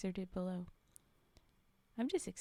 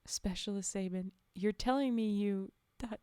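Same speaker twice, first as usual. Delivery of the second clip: ordinary delivery, nothing marked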